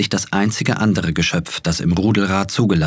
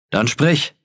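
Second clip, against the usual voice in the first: called out; 8 semitones higher than usual. A narrator's reading. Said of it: "riefen zwanzig Stimmen".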